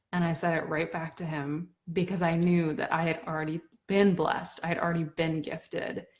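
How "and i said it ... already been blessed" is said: ordinary talk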